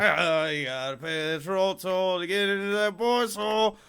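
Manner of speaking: lightly voiced crude old man, slow; slow hammed-up troll-toll line; Frank Reynolds impression. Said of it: "it still sounds like you're saying boys' hole".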